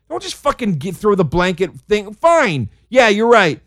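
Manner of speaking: normal talking voice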